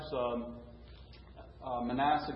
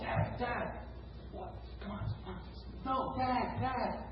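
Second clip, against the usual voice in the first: a lightly voiced small son, urgent and hushed; hushed little boy; 7 semitones higher, 8 dB quieter than usual. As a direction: hold him back in a hushed child's plea. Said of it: "And the son's going".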